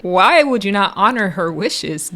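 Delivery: normal talking voice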